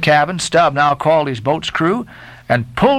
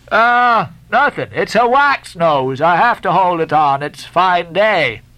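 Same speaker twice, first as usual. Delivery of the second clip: high, raised teasing voice; mocking sailor; 6 semitones higher than usual. A narrator's reading. Stubb mocking the Guernseyman about the smell. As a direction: needle him with cheerful scorn.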